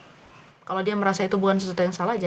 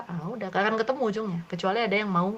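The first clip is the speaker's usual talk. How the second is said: ordinary delivery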